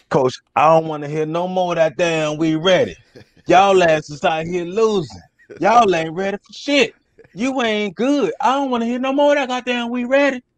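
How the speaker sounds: high-pitched mock rant of a fed-up football coach; Coach Sampson. Former player imitating his coach scolding the losing team, mock rant. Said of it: "Who's that, Coach Sampson?".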